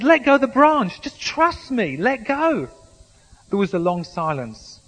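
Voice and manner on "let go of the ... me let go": higher, louder, firmly repeating the order; insistent Lord. Acted. God repeats the order to Jack, insistently. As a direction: insist he let go. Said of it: "I said".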